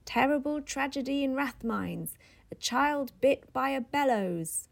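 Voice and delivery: newsboy crying the news in a man's face; newsboy's cry. Acted. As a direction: sell the paper by calling it out. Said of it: "A newsboy cried".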